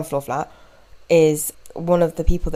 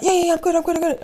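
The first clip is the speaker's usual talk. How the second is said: much higher, flustered reassurance; nervous man caught out; clearly nervous; 11 semitones higher, much faster than usual. Retold or imitated. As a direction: babble that you're fine. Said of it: "clearly nervous".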